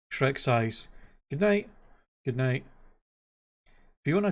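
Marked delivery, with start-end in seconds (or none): 1.3-1.7 princess bidding good night. A narrator's reading. Fiona bids good night. 2.2-2.6 reply evenly, dropping the pitch; ogre answering good night. A narrator's reading.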